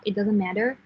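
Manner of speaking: ordinary talk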